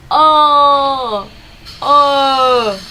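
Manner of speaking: loud, held open "Oh" with mouth wide; vowel demo. Shown as a demonstration. Teacher showing the mouth shape, loud held Oh. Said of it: "how is the mouth ... this vowel".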